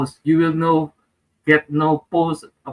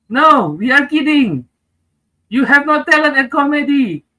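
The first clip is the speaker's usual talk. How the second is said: mocking friends; 10 semitones higher, 4 dB louder than usual. Friends mocking him in quote.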